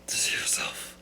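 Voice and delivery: exhausted, whispery movie-hero murmur; tired breathy voice. Acted. Friend doing his famous line, tired breathy voice. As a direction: let the breath swallow the words. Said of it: "He's really tired. He does a lot of breathing".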